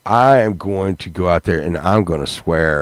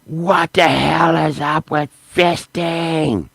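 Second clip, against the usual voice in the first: high, slow put-on voice of a ranting lady; mock crazy lady; 7 semitones higher, slower than usual. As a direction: drag it out like a scandalized old lady. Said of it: "she's screaming on YouTube".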